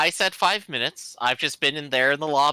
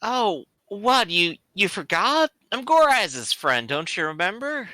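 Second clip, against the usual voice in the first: slightly put-on, higher-pitched protest; lightly voiced chummy Wookiee; 9 semitones higher than usual. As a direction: plead familiarity, pitched up in character.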